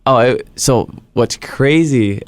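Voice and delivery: ordinary talk, nothing marked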